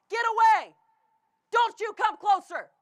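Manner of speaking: sharp shout to drive a dog off. Retold or imitated. Preacher shouting at a chasing dog to get away. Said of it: "I start shouting at the dog".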